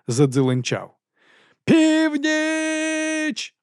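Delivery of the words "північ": very high, long-held cry; bellowed watch call. A narrator's reading. Eager Carrot rings the bell hard for midnight, bellowing. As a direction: bellow midnight like a town crier. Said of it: "волав він".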